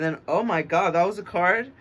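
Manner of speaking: loud, high oh my God; excited surprise. Real, not acted. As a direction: gasp it out as the card pops. Card jumps out, excited.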